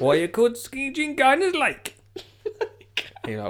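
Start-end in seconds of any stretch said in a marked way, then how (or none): 0.0-1.9 high-pitched mock Geordie interviewer; Newcastle accent. Newcastle DJ interviewing gardeners, mock Geordie accent. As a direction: quiz the guests in broad, hammed-up Geordie. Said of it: "they will ask you".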